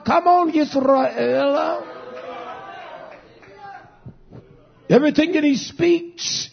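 preaching at full force. Acted. Preacher calling the wicked cast down, fervent preaching. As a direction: drive it home to the pews.

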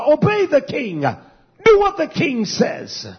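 high-pitched command, stretched at the end; mildly dramatised proclamation; herald crying out. Acted. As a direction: cry it out as a herald's order. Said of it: "him, cried out".

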